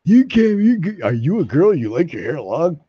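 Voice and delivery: high, wavering sing-song jeer; mocking taunt. Long-haired hippie mimics those asking if he's a girl, mocking sing-song. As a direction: mimic a teaser with swooping pitch.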